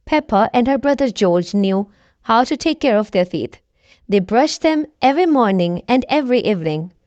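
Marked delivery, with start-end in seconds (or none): none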